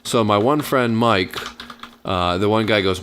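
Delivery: normal talking voice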